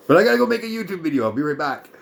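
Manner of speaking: high, fast mocking voice of her; sarcastic fast mimic, high pitched; squeaky rushed parody of the busy official; mocking impression.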